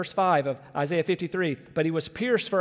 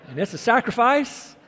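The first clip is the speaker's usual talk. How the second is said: laughing; 5 semitones higher, 4 dB louder than usual. Retold or imitated. Mock complaint with laughter.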